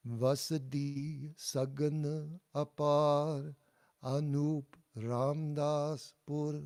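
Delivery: slow group lead of the full line; slow model line. Shown as a demonstration. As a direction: lead the class through the full line evenly. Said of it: "And now all together".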